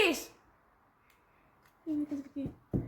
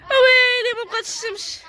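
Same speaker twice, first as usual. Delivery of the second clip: mock lament; 6 semitones higher, 4 dB louder than usual. Laments sun is gone.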